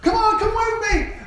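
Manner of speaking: imitating an excited leader calling followers; eager invitation. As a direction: pull them along, all excited. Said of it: "you're all excited about it".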